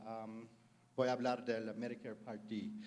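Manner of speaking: ordinary delivery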